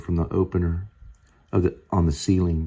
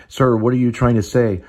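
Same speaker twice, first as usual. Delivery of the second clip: louder and higher, pressing the question; urgent plea; 5 semitones higher, 6 dB louder than usual. Acted. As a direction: push him to speak up. Rescuer pleading with mumbling man.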